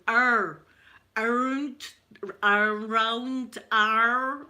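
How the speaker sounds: effortful "around" with an untrilled R; "arr" drawn out, failing to trill; failed rolled R. Retold or imitated. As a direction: imitate learners saying "Arr" with no tongue roll. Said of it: "And they'd be going".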